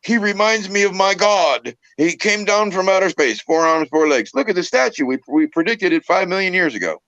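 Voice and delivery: mock believer. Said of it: "from another country going".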